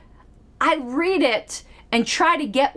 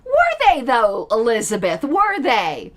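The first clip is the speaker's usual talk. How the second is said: pointed sceptical challenge. Acted. Critic pressing Elizabeth on her claim, pointedly sceptical. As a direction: press the doubt harder, teasingly.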